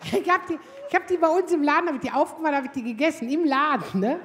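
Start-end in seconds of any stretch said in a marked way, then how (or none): none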